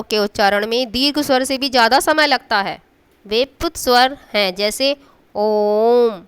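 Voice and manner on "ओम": ओम drawn out and held; long-held vowel. Shown as a demonstration. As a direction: stretch ओम out like a chant. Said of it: "ओम बहुत ज़्यादा बोला जा रहा है".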